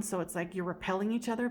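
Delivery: normal talking voice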